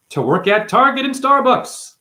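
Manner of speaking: high, rising loud punchline; exclaimed punchline. Acted.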